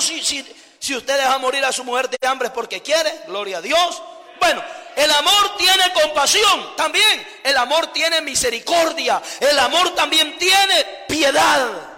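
loud, rousing sermon, scolding lazy husbands; fiery preaching. Acted. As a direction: preach at full force to the church. Preaching at full force. (predicación enérgica y a gritos)

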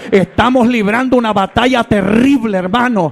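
rising, driving sermon voice, building to a shout; fiery preaching. Acted. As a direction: preach it hard and fast to the congregation. Predicador en pleno sermón. (predicación ardiente que va creciendo hasta gritar)

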